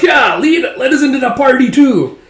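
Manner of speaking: high, loud whooping yell; wild high-pitched goon voice; rowdy villain henchman. Acted. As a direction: yell like a raider crashing in. Attacking bug gang whoops.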